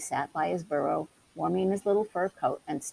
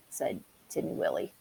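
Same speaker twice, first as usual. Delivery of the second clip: plain reading voice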